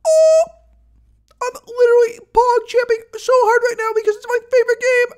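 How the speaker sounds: high, loud, gushing fangirl burst; thrilled squeal. Real, not acted. Streamer to chat about her favorite game, squealing high and loud. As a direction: squeal with pure delight over the game.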